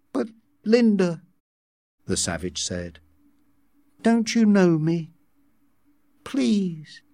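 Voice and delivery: high, hurt plea; pleading. A narrator's reading. Savage begging Linda to know him, pleading.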